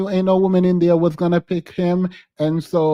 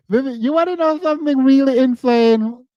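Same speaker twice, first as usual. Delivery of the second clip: excited buildup; 7 semitones higher, 3 dB louder than usual. Real, not acted. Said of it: "building excitement".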